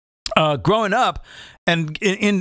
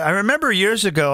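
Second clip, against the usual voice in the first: ordinary delivery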